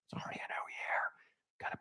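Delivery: whispered aside, breathy and hushed. Real, not acted. Whispering a correction to himself, a quick whisper. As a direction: drop to a whisper for a quick self-note.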